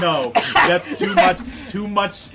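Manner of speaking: laughing, slow and loud; cracking up saying no; laughing protest. Real, not acted. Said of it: "too deep in the throat".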